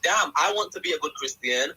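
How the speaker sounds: ordinary talk, nothing marked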